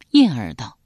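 usual speaking voice

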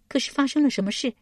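high-pitched surprised woman's question. A narrator's reading. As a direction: ask in a high character voice, taken aback. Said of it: "极是意外，问道". (高声意外地问)

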